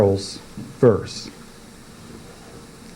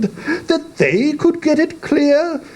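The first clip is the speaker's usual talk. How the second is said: much higher, pompous questioning voice; walrus. Acted. Walrus asking the carpenter about clearing the sand, high. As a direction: ask grandly as the walrus. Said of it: "the walrus said".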